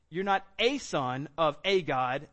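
ordinary talk, nothing marked

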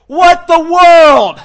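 drawn-out, very high, loud shout; furious yell. Retold or imitated. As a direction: yell in disbelief at the driver who bailed. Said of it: "I'm just yelling at him".

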